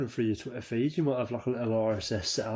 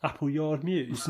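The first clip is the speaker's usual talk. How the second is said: gas man; 5 semitones higher, slower than usual. Retold or imitated. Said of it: "British gas geezer that's like".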